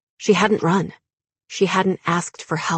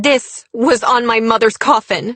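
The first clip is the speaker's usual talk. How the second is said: loud, higher, forceful; angry confrontation; 5 semitones higher, 6 dB louder than usual. A narrator's reading. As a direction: confront him with the evidence. Heroine confronts him with evidence.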